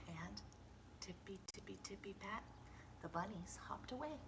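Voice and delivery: soft, whispered bunny steps; sneaky hush. A narrator's reading. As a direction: tiptoe the words in. Bunnies leave quietly.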